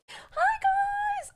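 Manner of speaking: high-pitched, drawn-out squeal; squealed greeting. Real, not acted. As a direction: squeal hello at your fans.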